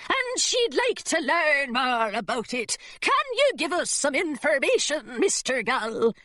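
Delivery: put-on governess putting on a rough pirate accent; thick pirate accent. Governess fooling the secretary in a thick pirate accent. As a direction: growl it like an old sea dog, in a pirate accent. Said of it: "in a thick pirate accent".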